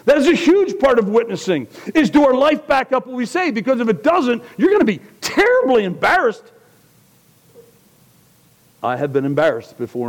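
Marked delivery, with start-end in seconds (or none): 0.1-6.4 loud, high preaching voice; preaching at full force. Acted. Pastor preaching hard to his congregation, loud and high. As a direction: preach it out to the church with fire.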